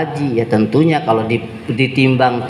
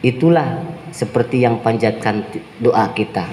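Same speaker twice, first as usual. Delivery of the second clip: ordinary talk, nothing marked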